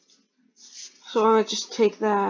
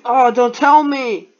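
dismayed exclamation; 8 dB louder, slower than usual. Real, not acted. Opponent plays threat.

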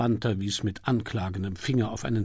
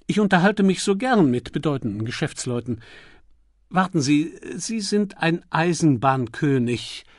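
higher, louder gushing dowager; gracious old duchess; 6 semitones higher, 6 dB louder than usual. Acted. The Duchess invites Milligan to sit beside her, gushing. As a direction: beam and draw him onto the sofa.

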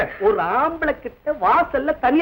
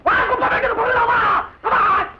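high, taunting yell; shouted challenge; 8 semitones higher than usual. Acted. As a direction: goad the rival into a fight. (ஆவேசமான சவால்)

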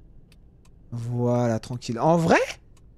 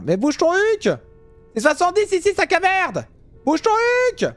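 high furious road-rage shout at another driver; angry yell; 13 semitones higher, 3 dB louder, slower than usual. Real, not acted. Driver yelling at a slow car ahead, furious shout. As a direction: blast him for crawling along.

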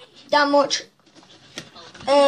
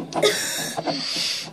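harsh coughing from spice; coughing fit; 7 dB quieter than usual. Real, not acted. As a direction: cough from the burn. Swallowed hot sauce.

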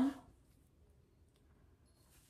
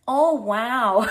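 bluffing commentary; 6 dB louder than usual. Retold or imitated. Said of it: "bullshitting my way in there".

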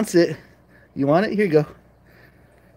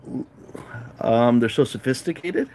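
ordinary talk, nothing marked

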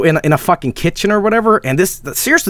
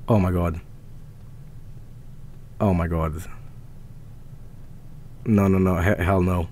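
low, soft muttering; shaken; 12 dB quieter than usual. Real, not acted. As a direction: mutter to yourself, drained after a fright. Recovering from scare.

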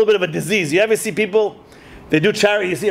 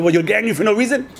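normal talking voice

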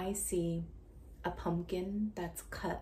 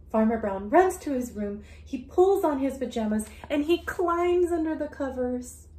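much louder, higher excited read; dramatic reading; 8 semitones higher, 13 dB louder than usual. Acted. Teacher reading to a child, big and excited. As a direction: rush into the scared moment.

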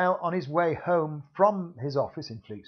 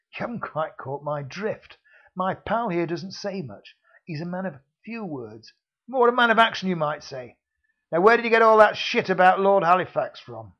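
menacing heavy growing louder; sinister thug; 4 semitones higher, 7 dB louder than usual. Acted. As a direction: let the threat sink in. Villain threatens violence.

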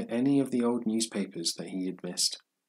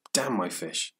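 ordinary delivery, nothing marked

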